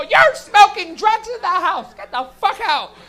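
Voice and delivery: loud, high mock yelling; drawn-out outraged mom shout; angry mother. Comic as mom catching the smoker, hammed-up angry yell. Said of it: "My mom could open the door and go".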